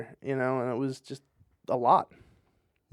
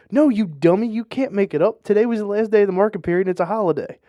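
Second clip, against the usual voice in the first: exasperated mom; 6 semitones higher, 6 dB louder, faster than usual. Retold or imitated. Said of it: "her mom's like".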